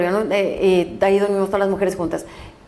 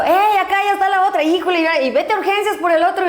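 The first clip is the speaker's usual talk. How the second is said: higher, raised voice barking orders across the ward; calling out orders; 7 semitones higher, faster than usual. Retold or imitated. Senior doctor shouting orders at the intern, raised and urgent. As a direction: call it out like a rushed senior doctor.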